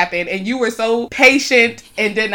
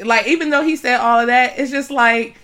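ordinary delivery

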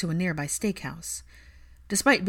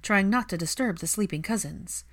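ordinary delivery

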